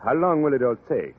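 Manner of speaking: put-on thick French accent, a charming Frenchman; French accent, courtly and flattering. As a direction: play the suave Parisian teacher, French accent.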